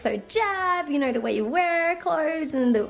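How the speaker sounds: put-on kid in class. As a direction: mimic an earnest kid butting in. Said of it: "the kid opposite me was like".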